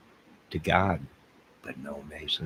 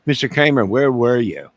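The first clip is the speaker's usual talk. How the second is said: higher, louder questioning as a detective; playing the interviewer questioning himself, higher and louder; mock interrogator; 4 semitones higher, 7 dB louder than usual. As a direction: grill yourself like a cop. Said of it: "I'm gonna interview myself".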